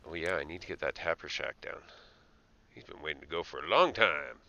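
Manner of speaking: ordinary delivery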